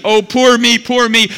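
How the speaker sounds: mock self-pity. Preacher mocking the self-pitying believer, whiny and high. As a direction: lay on the self-pity like a sulking child. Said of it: "we're self-pity".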